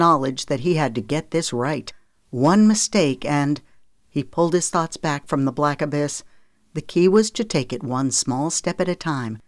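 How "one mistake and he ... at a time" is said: ordinary delivery, nothing marked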